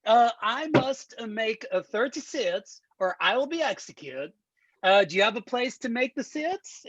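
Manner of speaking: put-on eager Borat accent sales pitch. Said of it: "do the entire demo in Borat voice".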